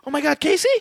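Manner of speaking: high-pitched imitation of a panicked mother; mock freak-out. Friend retelling the mom hearing Casey on the phone, mock panic. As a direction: act out freaking out, pitch jumping way up. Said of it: "they're freaking out".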